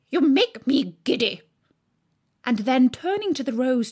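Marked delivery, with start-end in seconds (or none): 0.1-1.4 louder, higher, fed up; irritated queen. A narrator's reading. Queen snapping at the bowing crowd. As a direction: snap it out. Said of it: "screamed the Queen".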